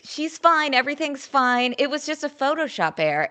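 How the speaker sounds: high-pitched dismissive mimicry; mock reassurance. Mimicking credulous press excusing the palace.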